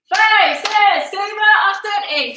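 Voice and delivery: loud, fast count call. Real, not acted. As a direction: shout the count to the class. Calling counts over music.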